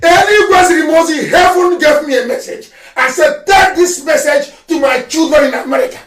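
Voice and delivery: loud proclamation of a divine message; shouted prophecy. Real, not acted. Leader passing heaven's warning to his people, loud proclaiming. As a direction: declare it like a prophet, full voice.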